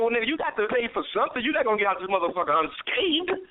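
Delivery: a hint of a stern inner voice, fast. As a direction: lecture yourself quickly. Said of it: "the higher self and I, said".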